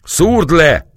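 high, loud, urgent order; shouted command. Acted. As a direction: yell it as an order to strike. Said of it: "kiáltotta a sátorbontó".

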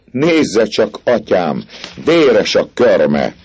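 high, loud, jeering cry; slow, drawn-out mockery on the last words; shrill mocking taunt. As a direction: throw it in his face with scorn. Girl mocks the priest.

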